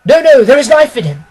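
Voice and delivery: loud, high shout from the crowd; a bystander's loud high shout. A narrator's reading. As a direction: call it out over the crowd. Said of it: "so shouted another".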